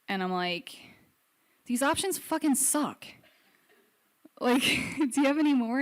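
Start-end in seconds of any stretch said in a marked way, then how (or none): 1.7-5.8 high-pitched, incredulous retort, a laugh breaking in; exasperated protest. Retold or imitated. Patient to surgeon over bad options, exasperated. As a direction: protest in disbelief at a raised pitch.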